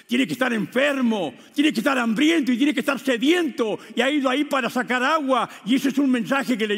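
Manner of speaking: full-force preaching. Acted. Preacher urging the congregation, loud and driving.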